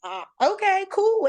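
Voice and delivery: high, surprised okay, cool; stunned okay. Retold or imitated. Caught off guard by the lady offering an interview, surprised. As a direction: play it cool while caught off guard, pitch jumping up. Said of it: "And I was like".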